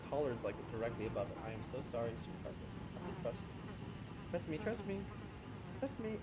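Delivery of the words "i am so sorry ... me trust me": soft, hushed murmur to a pet; whisper-soft comfort while untangling her; tender apology. Real, not acted. Streamer tenderly apologizing to his cat while freeing her, very soft.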